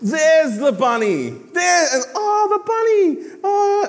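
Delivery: high, bright delighted reveal; excited peekaboo reveal. Retold or imitated. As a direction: squeal it to a baby. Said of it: "And then I'll go".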